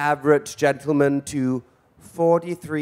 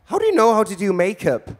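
impressed friend; 6 semitones higher, 4 dB louder, faster than usual. Retold or imitated. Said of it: "And all of a sudden said".

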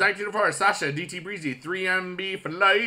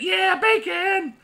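mock fan voice; 6 dB louder, much slower than usual. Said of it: "he's like".

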